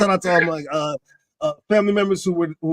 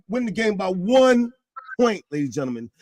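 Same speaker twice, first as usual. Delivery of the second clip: ordinary talk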